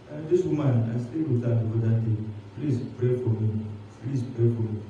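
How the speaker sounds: low, soft, hurried confession; humbled confession. Retold or imitated. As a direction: beg the church for prayer. Pastor confessing sin.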